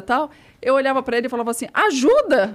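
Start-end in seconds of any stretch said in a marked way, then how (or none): 1.7-2.6 loud, raised cry for help; retold urgent call. Journalist urging the supposed nurse to act, loud mock call. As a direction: call it out sharply, as if ordering aid.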